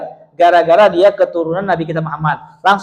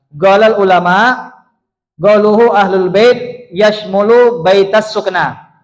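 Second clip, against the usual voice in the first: slow, louder chanted Arabic quote; Arabic recitation; 2 semitones higher, 3 dB louder, slower than usual. Acted. Preacher reciting the Arabic quote to the congregation in slow chant. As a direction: recite the scholar's words in a measured chant.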